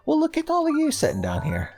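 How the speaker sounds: high doting voice for the little birds; cooing at cute birds. Acted. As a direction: coo over them like they're adorable pets.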